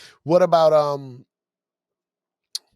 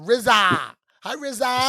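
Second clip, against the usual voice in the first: rizza with an open ah ending, higher and louder; New York accent; 9 semitones higher, 6 dB louder, slower than usual. Shown as a demonstration. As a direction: greet him with a proud New York rizza. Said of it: "'Cause I call rizza".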